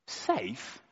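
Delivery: a breathy, incredulous "Safe?"; Mister Beaver surprised at Susan's question, whispered; whispered disbelief. Acted. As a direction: scoff at the word under your breath. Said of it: "said Mister Beaver".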